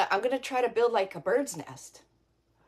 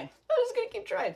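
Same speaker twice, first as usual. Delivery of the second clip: high sing-song repeat; 14 semitones higher than usual. Pretend. Host on a timed craft event repeating it in a high playful lilt. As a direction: jump way higher, playful lilt.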